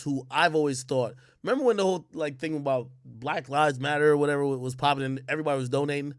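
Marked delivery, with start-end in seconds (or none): none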